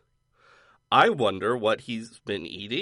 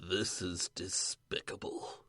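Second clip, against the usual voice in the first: lightly voiced slow vampire accent; Transylvanian accent; 5 semitones lower, 13 dB quieter, slower than usual. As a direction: drawl it softly like a vampire. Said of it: "Guy from Vampire Voice".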